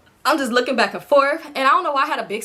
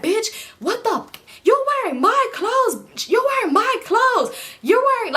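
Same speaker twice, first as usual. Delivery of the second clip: high-pitched slow scream; 10 semitones higher, slower than usual. Retold or imitated. Friend screaming at the homegirl over her clothes. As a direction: shriek it out, over and over. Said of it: "she just kept screaming".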